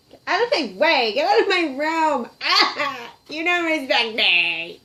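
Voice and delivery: put-on slam-the-door teenager yell; teen yelling. Teen puppet yells at mom to leave her room.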